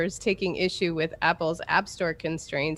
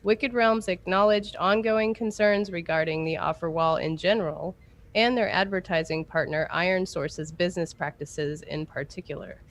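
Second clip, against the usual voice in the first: ordinary delivery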